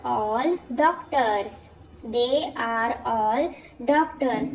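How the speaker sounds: very slow, word by word; slow dictation. Shown as a demonstration. Teacher dictating an example sentence slowly. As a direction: read it slowly for the class. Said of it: "तो इसका sentence है".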